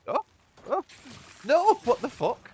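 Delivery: real startled outburst; shocked yell. Real, not acted. Sudden shock in the game.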